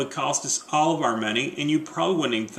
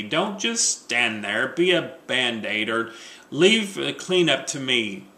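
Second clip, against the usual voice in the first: ordinary talk, nothing marked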